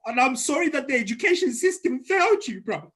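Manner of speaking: high, loud and overcome with emotion; real tearful outburst. Real, not acted. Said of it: "I can't stop crying".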